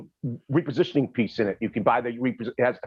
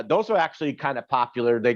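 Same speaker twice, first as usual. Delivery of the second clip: usual speaking voice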